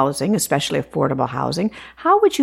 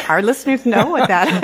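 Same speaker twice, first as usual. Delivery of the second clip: laughing; 5 semitones higher than usual. Real, not acted. Laughs at own slip, readers for listeners.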